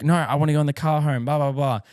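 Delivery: half-hearted imitation of a sobbing child; crying toddler. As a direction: play the daughter crying for the car. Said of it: "And she cries".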